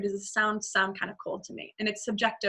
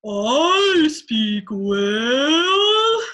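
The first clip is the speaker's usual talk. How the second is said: slow, stretched whale-speak, loud; dark, dragged-out whale voice; Dory speaking whale; 3 semitones higher, 10 dB louder, much slower than usual. Shown as a demonstration. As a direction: draw every word out like a whale call. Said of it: "Dory speaks whale".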